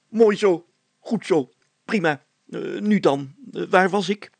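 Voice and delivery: high, loud self-important mayor voice; pompous mayor. A narrator's reading. Mayor threatening Brilstra with a fine, pompous and high. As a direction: lecture him like a puffed-up mayor.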